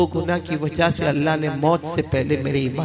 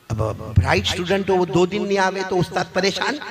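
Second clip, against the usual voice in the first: usual speaking voice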